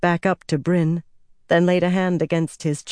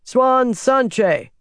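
higher, insistent hail by name; calling out; 8 semitones higher, 4 dB louder than usual. A narrator's reading. A rider hails Swan by full name, calling out. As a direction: call out the full name to get attention. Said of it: "a voice called".

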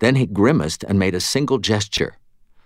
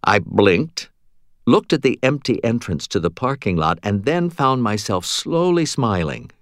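plain reading voice

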